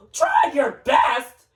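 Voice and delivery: high, slow, incredulous shout; outraged high-pitched retort; indignant shout. Acted. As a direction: throw it back loudly, stretching each word. Acted quarrel over shoe size.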